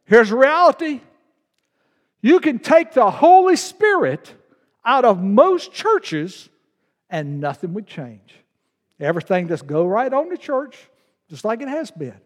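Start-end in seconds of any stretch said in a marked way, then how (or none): 0.0-6.5 louder and higher, hammering each phrase; emphatic preaching. Real, not acted. Pastor confronting the church about the Spirit, raised preaching voice. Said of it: "Now listen". 7.1-8.3 dropped quiet. Real, not acted. Pastor landing his point to the congregation, quiet. Punchline after raised build.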